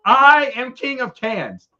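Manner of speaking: mock-regal declaration; hammed-up king. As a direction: proclaim it like a pompous monarch. Playing a self-crowned king.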